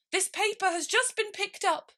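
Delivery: high, flustered rabbit voice. Acted. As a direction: squeak it out in a hurry.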